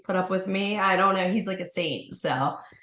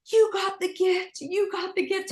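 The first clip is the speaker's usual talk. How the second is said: manic; 13 semitones higher than usual. Retold or imitated. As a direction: shake him awake with high giddy words. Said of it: "I go".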